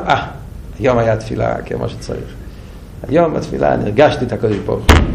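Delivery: low, soft voice of a self-satisfied worshipper; contented sigh, acted. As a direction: play quiet satisfaction after praying. Voicing a man content after prayer. (אנחת סיפוק שקטה ומבוימת)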